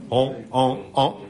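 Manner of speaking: honking like a goose. Retold or imitated. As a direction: imitate geese honking in flight. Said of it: "you ever heard geese honk?".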